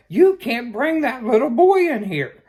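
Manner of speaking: high, loud repeated warning yell; shouting clerks. Retold or imitated. Liquor store staff shouting the rule again, loud and high. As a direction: shout it at a customer who didn't hear. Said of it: "starts shouting at me".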